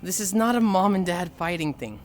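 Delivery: ordinary talk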